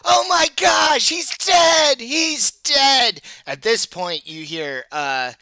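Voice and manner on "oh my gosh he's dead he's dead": panicked high-pitched shouting; Iliard. Acted. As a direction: scream the alarm at full voice. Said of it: "Iliard, the, the halfling, say".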